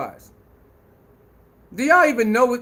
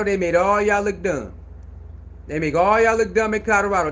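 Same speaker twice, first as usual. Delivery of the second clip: usual speaking voice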